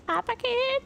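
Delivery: high call across the yard. Retold or imitated. Calling out to Pop from the stalled car. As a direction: call his name up high to get attention.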